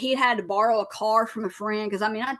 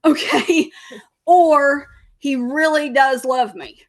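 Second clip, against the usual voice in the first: laughing it out, louder and higher; laughing disbelief; 5 semitones higher, 8 dB louder, slower than usual. Retold or imitated. Wife marveling at husband's devotion, laughing louder. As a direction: laugh at how crazy he's acting.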